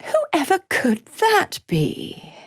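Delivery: high, slow, teasing wondering; feigned innocence. Acted. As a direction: play coy, drawing it out up high. Villain feigns ignorance, teasing.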